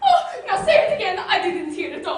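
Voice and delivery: giddy laughing plea; overjoyed laughing request to hear it again; laughing with joy. Acted. Overjoyed father laughs at proposal.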